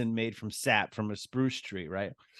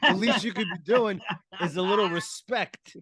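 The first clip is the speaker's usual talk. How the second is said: pimp voice; 9 semitones higher than usual. Retold or imitated. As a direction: scold her like a stingy pimp. Continuing the pimp voice.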